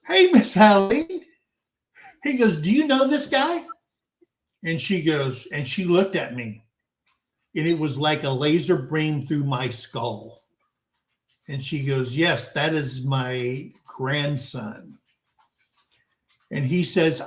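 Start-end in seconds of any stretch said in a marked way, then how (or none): 0.1-3.7 higher, mock-friendly cop voice; imitating the cop. Cop at grandmother's door. 12.2-15.0 slow, low, grim grandmother; grandma confirming to the cop, slow and ominous; stern grandmother. Retold or imitated.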